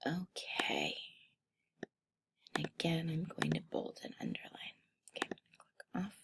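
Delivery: muttering under her breath to herself while clicking; whispering softly, talking to herself as she works; whispered aside. Real, not acted.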